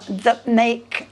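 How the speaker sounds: ordinary delivery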